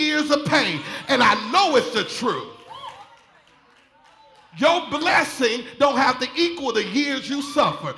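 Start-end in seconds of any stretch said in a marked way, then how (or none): 4.6-7.9 full-force preaching. Acted. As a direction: build it up to the shout. Preacher building to climax.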